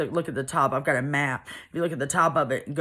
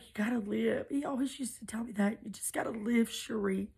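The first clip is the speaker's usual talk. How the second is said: softly, almost whispered; hushed plea; 6 semitones higher, 6 dB quieter than usual. Retold or imitated. Brother quietly urges his sister to survive, hushed. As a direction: breathe it to her like a promise. Brother comforting her at pond.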